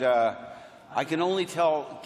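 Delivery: ordinary talk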